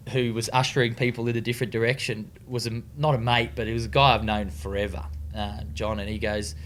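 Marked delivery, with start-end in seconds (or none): none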